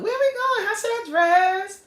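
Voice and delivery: put-on high, eager girlish voice; excited date. Vlogger acting out a date who is eager to go out, high and excited. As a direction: play the thrilled date asking what to wear.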